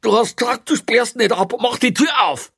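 high, loud, accusing shout; panicked yell. A narrator's reading. Prisoner demanding the door be opened, panicked yell. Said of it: "schrie Kreutner mit schreckgeweiteten Augen an".

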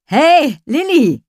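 high, loud call across the path. Acted. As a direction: call out to a friend. Boy calls to her from behind.

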